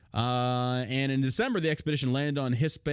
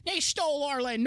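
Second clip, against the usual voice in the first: much higher whiny mockery; mocking whiner voice; 14 semitones higher than usual. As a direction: whine it like a crybaby.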